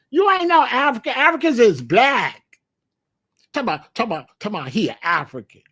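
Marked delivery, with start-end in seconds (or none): 0.1-2.4 put-on higher and louder, a scoffing street kid; teasing shout at the newcomer; mocking kid. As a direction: call him out like a cocky kid. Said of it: "they gonna be like".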